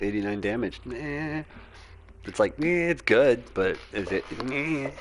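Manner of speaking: usual speaking voice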